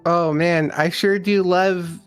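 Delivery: ordinary delivery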